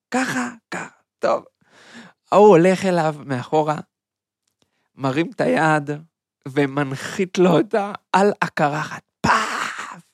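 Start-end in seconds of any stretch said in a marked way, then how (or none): none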